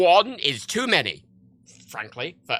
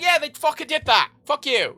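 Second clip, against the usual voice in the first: high, indignant snap back; exasperated retort; 10 semitones higher than usual. Real, not acted. Answering those shocked too late, exasperated. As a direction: throw it back at them, pitched up.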